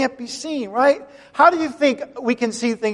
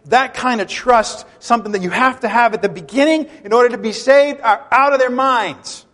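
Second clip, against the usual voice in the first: normal talking voice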